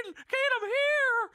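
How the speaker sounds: very high, slow, drawn-out cry; stretched ghostly call from the distance; distant Paul Bearer yell. Retold or imitated. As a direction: wail it from far away. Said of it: "Paul Bearer in the distance yelling".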